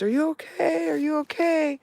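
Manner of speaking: high, loud calls into the trees; yelling into woods. Retold or imitated. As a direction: shout into the forest for a lost man. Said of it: "screaming into the woods".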